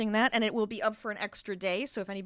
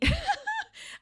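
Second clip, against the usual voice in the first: high burst of laughter; bright giggle; 3 dB louder than usual. Real, not acted. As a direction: laugh at herself. Laughing about many patterns.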